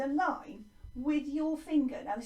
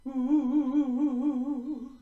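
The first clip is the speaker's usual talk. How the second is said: loud ooh wavering evenly; bobbing, sustained vocal wobble; wobbly "ooh"; 9 dB louder than usual. Shown as a demonstration. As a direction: wobble a held ooh in little waves. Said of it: "do the wobbly bit now".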